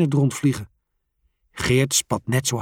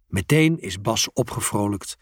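plain reading voice

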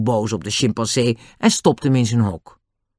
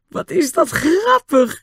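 high, slow, helpless with laughter; laughing; slower than usual. Acted. Annie watching the chimp tease the dogs, laughing till she cries. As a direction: squeeze it out through giggles. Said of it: "veegde de tranen uit haar ogen".

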